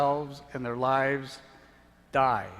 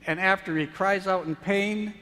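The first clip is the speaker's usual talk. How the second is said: loud, higher, driving sermon narration; preaching at full force; 5 semitones higher, 6 dB louder than usual. Acted. Preacher to congregation, full force. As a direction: hammer each blow home.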